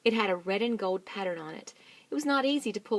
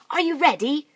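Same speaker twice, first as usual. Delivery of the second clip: high, loud eager question; rallying call; 8 semitones higher, 12 dB louder than usual. Acted. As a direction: rouse the team. Mugglewump rallying.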